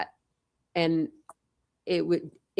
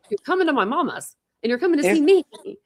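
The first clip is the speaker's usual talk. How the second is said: higher, louder playful insistence; insistent excitement; 8 semitones higher, 5 dB louder than usual. Retold or imitated. Urging friend to visit mama's, raised insistent voice. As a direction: push her to come, bright and pushy.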